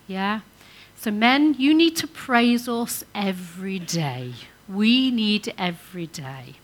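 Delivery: normal talking voice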